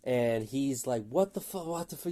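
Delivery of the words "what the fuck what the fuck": imitating a panicked passenger, higher; mock freak-out from the passenger seat; lightly acted panicked friend. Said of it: "He's, like, spazzing out".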